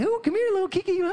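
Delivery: very high cooing to a little dog; sweet pet voice. Retold or imitated. Owner greeting his dog Kiki after work, sweet voice. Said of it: "I'm making sweet voice".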